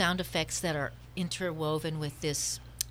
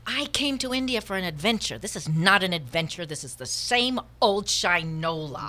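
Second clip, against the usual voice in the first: exasperated complaint; 3 dB louder than usual. Acted. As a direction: vent bitter disappointment at your companion. Acted quarrel, complaint continues.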